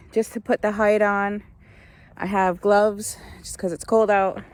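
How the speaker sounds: normal talking voice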